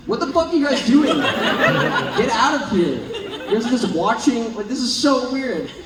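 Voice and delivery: half-laughing mock outrage; imagined baffled outsider. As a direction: act out a bewildered stranger walking in. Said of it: "they'd be like".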